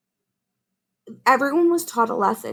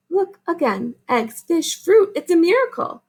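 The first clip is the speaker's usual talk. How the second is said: excited exclaim; 8 semitones higher than usual. Acted. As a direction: gasp it out like a wonderful surprise. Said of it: "exclaimed Mama".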